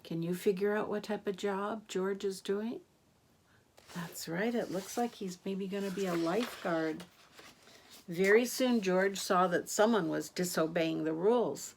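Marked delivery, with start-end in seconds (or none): none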